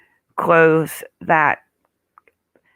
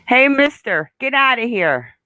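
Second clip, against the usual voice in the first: shooing; 5 semitones higher than usual. Real, not acted. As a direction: chase the rooster off. Shooing the rooster.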